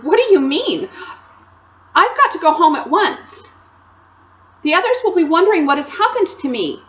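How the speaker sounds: put-on high, loud anxious girl voice; rather frightened. A narrator's reading. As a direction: protest in rising alarm. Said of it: "feeling rather frightened".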